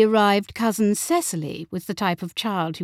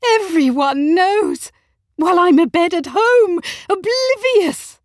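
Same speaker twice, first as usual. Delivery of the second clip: outraged, wounded wife; trembling outrage; 12 semitones higher, 8 dB louder than usual. A narrator's reading. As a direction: wail the grievance at full voice. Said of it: "voice was trembling with outrage".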